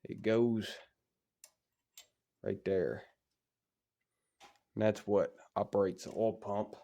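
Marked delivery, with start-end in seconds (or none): none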